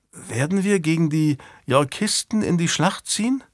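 lightly voiced young squire. A narrator's reading. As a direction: lift the pitch for the lad's question. Squire questions his master.

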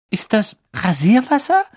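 put-on girl choking on a terrible smell; half-choked voice. A narrator's reading. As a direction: ask while trying not to breathe. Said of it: "mit halb erstickter Stimme".

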